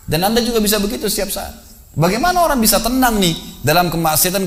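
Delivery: high, urgent sermon warning; preaching at full force. Acted. Preacher urging the mosque congregation, fervent and high. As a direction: press the warning on the crowd.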